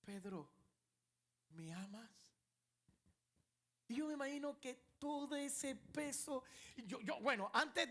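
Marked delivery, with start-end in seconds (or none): 0.0-2.1 the Lord asking a guilty Peter, sweet and soft. Acted. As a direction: ask it gently, as if forgiving. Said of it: "una voz dulce".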